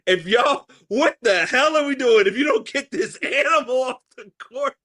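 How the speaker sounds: high, laughing rant at the ref; a laughing, hammed-up outraged parent; mock angry parent. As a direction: fume about a dog on the court. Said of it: "I'm like".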